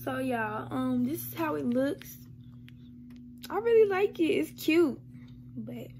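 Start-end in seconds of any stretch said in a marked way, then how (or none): 3.5-5.0 excited. Real, not acted. Delighted by the necklace.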